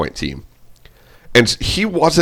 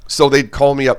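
ordinary delivery

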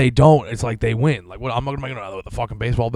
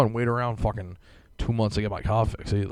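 ordinary talk, nothing marked

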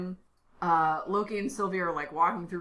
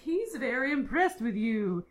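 higher, sing-along chipper cartoon greeter; Miss Minutes, chipper; 5 semitones higher than usual. Podcaster voices the character welcoming Loki and Sylvie, overly chipper. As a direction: imitate an overly chipper character in a put-on accent. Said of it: "She's way too chipper".

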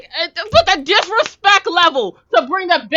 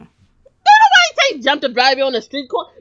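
usual speaking voice

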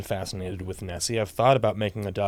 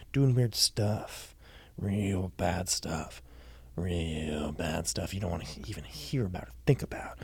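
nodding off. Acted. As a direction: drift off to sleep mid-sentence. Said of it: "Are you falling asleep?".

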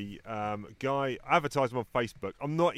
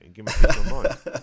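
laughing mock threat; 12 dB louder than usual. Real, not acted.

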